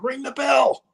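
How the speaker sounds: high, far-off shout; yelling. Retold or imitated. Boss at ringside shouting at the timekeeper, urgent shout. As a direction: shout the order across the ring. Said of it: "Vince is yelling out".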